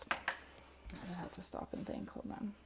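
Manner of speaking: whispering to herself; slow whisper under her breath; whispered aside. Real, not acted. Pausing to recall the message.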